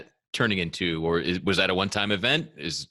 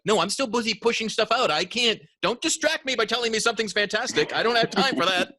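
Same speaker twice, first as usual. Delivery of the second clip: much higher, brushing it off in a rush; mock frantic busyness; 13 semitones higher than usual. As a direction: wave them off, too busy to listen. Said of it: "it was like".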